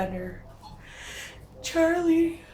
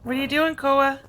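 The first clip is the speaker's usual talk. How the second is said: louder call; 6 semitones higher, 7 dB louder, faster than usual. Real, not acted. Calling out to a digging puppy.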